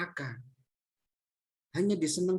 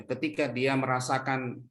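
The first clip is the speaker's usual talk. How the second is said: usual speaking voice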